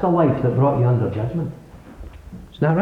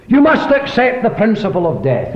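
ordinary delivery

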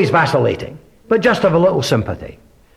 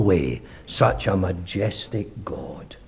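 whispered; 9 semitones lower, 9 dB quieter, slower than usual. Real, not acted. Preacher praying over his hearers, whispered in awe. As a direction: drop to a hushed whisper. Prayerful hush ending a blessing.

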